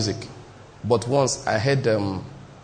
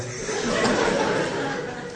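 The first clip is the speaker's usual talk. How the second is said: airy giggle, pitched up; breathy high laugh; 9 semitones higher, 6 dB quieter than usual. Real, not acted. Preacher giggling while telling congregation the tale. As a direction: let a wheezing laugh escape.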